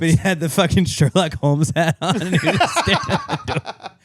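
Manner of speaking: talking through real laughter; laughing. Real, not acted. Recalling the Sherlock Holmes hat, laughing. Cracking up retelling the meme.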